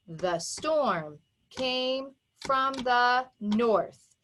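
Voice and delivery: very slow, beat by beat, gaps between words; measured, separated teaching rhythm; pounded out. Shown as a demonstration. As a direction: pound out "The storm came from the north" word by word. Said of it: "So watch me pound it".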